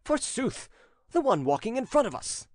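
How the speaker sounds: high-pitched, impatient master; impatient retort. A narrator's reading. Prince answering his evasive servant, impatient retort. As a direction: snap back, pitched well up.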